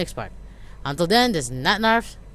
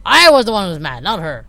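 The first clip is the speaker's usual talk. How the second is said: ordinary delivery, nothing marked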